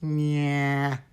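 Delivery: a long, held nasal "nya"; nasal puppet sound. Shown as a demonstration. Teacher demonstrating a puppet voice's core sound, very nasal. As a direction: drone it from back of nose and throat. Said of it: "a very nasal sound".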